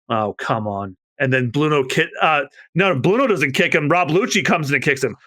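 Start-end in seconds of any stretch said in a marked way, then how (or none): none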